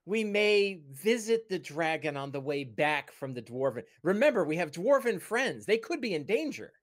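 high, tentative, halting counsel; cautious companion character. Acted. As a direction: tactfully talk a friend out of danger. Game master voices cautious companion.

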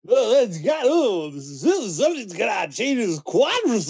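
high, hammed-up race announcer voice; Greg Proops. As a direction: call it like an over-excited commentator. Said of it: "you had Greg Proops doing the".

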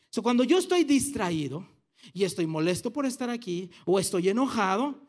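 ordinary talk, nothing marked

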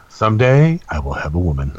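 low, blunt caveman delivery. Shown as a demonstration. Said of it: "You sound like a caveman".

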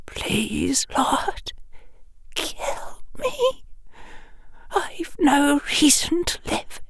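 high, hushed, pleading whisper; calm tearful whisper. A narrator's reading. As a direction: beg softly through tears. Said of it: "Her voice seemed to calm".

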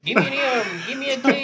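very high, loud laughing cheer; cheerleader spelling. Pretend. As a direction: shriek the letters like a cheerleader.

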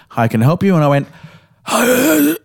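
usual speaking voice

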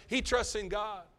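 high, loud, slow proclamation; preacher proclaiming to the church; preaching at full force. Real, not acted. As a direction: proclaim it with fire.

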